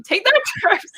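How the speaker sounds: laughing jab. Real, not acted. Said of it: "I didn't really mean it like that".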